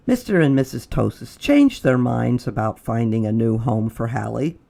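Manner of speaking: ordinary delivery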